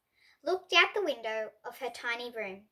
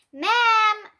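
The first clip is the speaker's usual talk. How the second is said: loud, high sudden call; 10 semitones higher, 11 dB louder than usual. A narrator's reading. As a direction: startle the teacher from behind. Imp calls from behind her.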